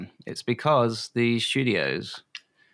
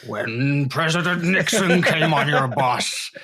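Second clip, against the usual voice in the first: higher, louder, slow Nixon voice; Nixon impression; 4 semitones higher, 4 dB louder, slower than usual. Dad doing Nixon for his son's bus game, slow and loud. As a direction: drag it out like a mock Nixon. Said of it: "a different voice for every passenger".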